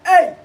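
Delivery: short, loud, high hey. Pretend. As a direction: yelp a quick high hey. High ad-lib among whoops.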